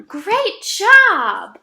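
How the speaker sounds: ordinary talk, nothing marked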